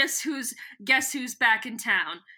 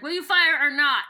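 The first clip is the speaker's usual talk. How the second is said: louder, punchier take of the demand; 4 semitones higher, 8 dB louder than usual. Shown as a demonstration. Retelling Gareth's demand again, louder. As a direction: bark the question. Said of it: "Or, or Gareth is like".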